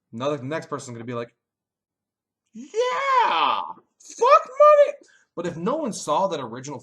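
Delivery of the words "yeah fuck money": screamed very high and loud; mock shout. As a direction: yell it like a riled-up mob. Next person joins the trolling.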